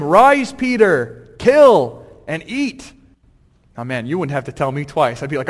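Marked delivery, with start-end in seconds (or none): 0.0-2.9 loud, very slow divine command; slow, loud heavenly voice; booming heavenly command. Acted. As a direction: proclaim it like a voice from heaven.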